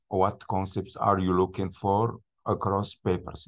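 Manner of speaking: English read aloud with a French accent; French speaker's halting English. Real, not acted. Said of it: "mon accent anglais".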